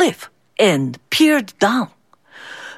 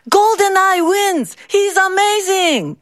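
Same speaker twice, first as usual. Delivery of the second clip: excited cheer; 10 semitones higher than usual. Acted. Crowd cheers the victory.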